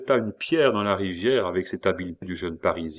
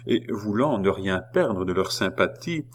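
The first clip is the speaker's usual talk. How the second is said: usual speaking voice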